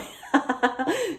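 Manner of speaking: short burst of real laughter; real, spontaneous giggling laugh; amused laugh. Real, not acted. Laughs at her own search history.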